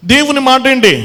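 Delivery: loud, ringing preacher's call, slowing on the last words; preaching at full force. Acted. Preacher calling out loudly to the congregation about the sick woman.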